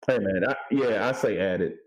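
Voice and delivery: ordinary talk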